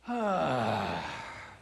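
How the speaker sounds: big breathy sigh out; demonstrated sigh. Shown as a demonstration. Speaker leading a vocal warm-up, loud sigh. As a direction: let all the air go in an audible sigh. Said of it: "One more time".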